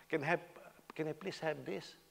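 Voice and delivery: soft, courteous request ending on a rise; polite American order. Acted. American customer ordering in a restaurant, polite and soft. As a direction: ask gently and deferentially. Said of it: "But America".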